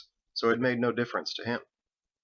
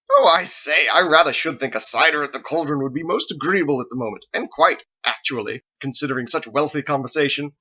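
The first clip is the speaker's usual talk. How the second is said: high, loud parody of Thomas; gleeful mock pomposity; 4 semitones higher, 7 dB louder than usual. A narrator's reading. Harold mimicking Thomas about cider, gleeful loud parody. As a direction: gasp with pleasure and ham it up. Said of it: "gasped with pleasure".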